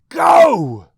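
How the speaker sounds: very loud, high scream. Acted. Mother screaming at her son to leave, full scream. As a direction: scream him out the door. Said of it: "she screams at him".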